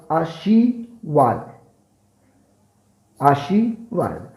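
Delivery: आशीर्वाद said slowly, each syllable drawn out; slow pronunciation demo. Shown as a demonstration. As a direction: spell out आशीर्वाद by sound, slowly. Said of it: "इनका उच्चारण करके देखते हैं". (आशीर्वाद धीरे-धीरे, हर अक्षर साफ़)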